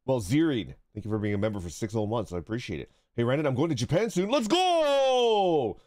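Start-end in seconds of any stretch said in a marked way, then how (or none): 4.3-5.8 high, long-held hype yell; whooping, drawn-out cheer; excited cheer. Real, not acted. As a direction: stretch out the last word in celebration. Cheering a viewer's Japan trip.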